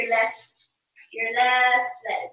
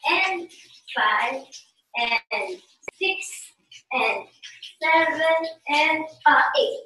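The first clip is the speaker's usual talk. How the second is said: dance count with long pauses between beats; slow, spaced-out teacher's count. Shown as a demonstration. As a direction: count the steps out very slowly. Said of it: "very slow".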